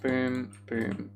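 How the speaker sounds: usual speaking voice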